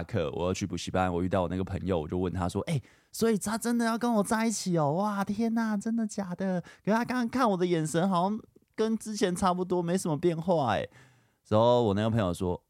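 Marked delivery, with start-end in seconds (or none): 2.7-10.9 much higher, breathless imitation of his thrilled younger self; eager disbelief. Teenage self asking his friend if she really said yes, thrilled and high. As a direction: ask like you can't believe your luck.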